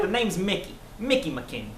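put-on high-pitched boy detective introducing himself; Mickey McKinney. Shown as a demonstration. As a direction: lift the voice into a boy's, then settle. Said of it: "I made myself high-pitched".

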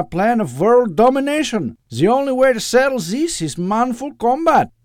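high, loud, theatrical villain rant; ranting supervillain. Acted. Eustace as Doctor Loeb overrules his lawyer, ranting grandly. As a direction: proclaim your evil with grand bombast.

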